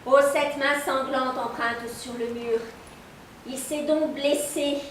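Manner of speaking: slow, drawn-out tragic declamation; reine d'Espagne. Acted. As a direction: declaim the queen's monologue slowly, word by word. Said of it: "elle joue la reine d'Espagne". (reine de tragédie, déclamation lente)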